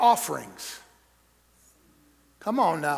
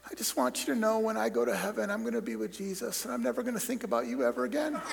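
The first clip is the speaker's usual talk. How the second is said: soft dying mom; 2 semitones higher, 9 dB quieter, faster than usual. Retold or imitated. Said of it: "and she said".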